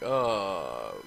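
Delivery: usual speaking voice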